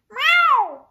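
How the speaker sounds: very high, loud meow; a loud yowl as the cat pounces; high, loud cat cry. Acted. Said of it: "Lady Boxer cried".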